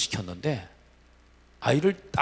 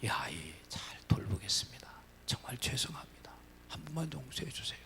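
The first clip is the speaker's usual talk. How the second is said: whispered plea; 12 dB quieter than usual. Retold or imitated. Teachers pleading with the missionary pastor, whispered begging. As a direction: beg in a breathless whisper.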